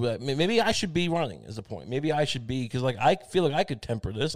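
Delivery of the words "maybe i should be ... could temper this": ordinary delivery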